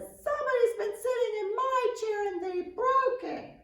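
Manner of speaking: very high, loud squeaky little bear cry; Baby Bear's wail. A narrator's reading. Little cub telling his parents his chair is broken, high and loud. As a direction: squeal it like a heartbroken tiny cub. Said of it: "And Baby Bear says".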